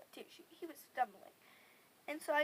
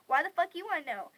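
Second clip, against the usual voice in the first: loud, higher snotty retort; bitchy; 4 semitones higher, 10 dB louder than usual. Retold or imitated. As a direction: throw it back loudly with attitude. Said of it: "one of those bitchy people".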